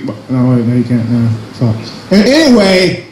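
ordinary talk

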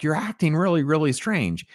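retold incredulous remark, high; mock disbelief. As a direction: voice the baffled police, pitched well up. Retells police confronting odd Bruce.

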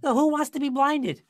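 high, loud, incredulous comeback; mock the question at full volume; smart-aleck retort. Young driver mouthing off to the officer, smart-aleck. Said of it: "used to being a smart aleck".

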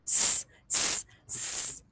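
a long soft unvoiced sss, like a snake; hissing s sound. Shown as a demonstration. Teacher to child, showing how the S sounds, a soft hiss. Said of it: "¿Cómo suena la S?".

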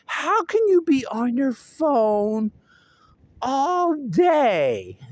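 high, drawn-out mock scolding; imitated mom. Imitating mom about phone use, exaggerated high scolding. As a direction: mimic a mother's nagging, stretched out and high. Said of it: "And Mom's like".

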